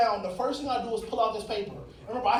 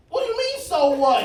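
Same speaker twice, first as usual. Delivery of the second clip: outraged disbelief; 6 semitones higher, 6 dB louder than usual. Retold or imitated. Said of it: "And I'm".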